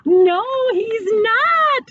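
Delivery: put-on the boy screams a warning at his mom. A narrator's reading. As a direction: scream the denial as a small boy. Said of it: "screamed Eddie".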